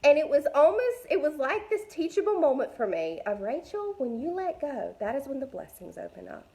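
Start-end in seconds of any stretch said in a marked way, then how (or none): 3.4-6.5 softer, tender reminder to herself; gentle inner voice. Retold or imitated. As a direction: speak like a kind inner voice. Inner voice teaching a lesson.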